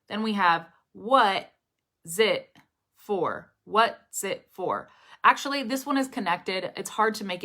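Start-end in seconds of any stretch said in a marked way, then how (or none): 0.9-4.9 "what zit for" slowly, one chunk at a time; spaced-out demo of "what zit for"; three separate words. Shown as a demonstration. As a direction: pause around "zit" in "what zit for". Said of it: "imagine this being three words".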